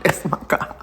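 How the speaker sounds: breathy laughing whisper of the claim. Real, not acted. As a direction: laugh it under your breath.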